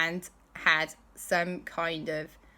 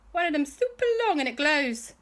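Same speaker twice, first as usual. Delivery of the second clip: excited showing off; 10 semitones higher, 3 dB louder than usual. Acted. Martian shows off glowing finger.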